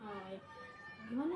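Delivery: ordinary talk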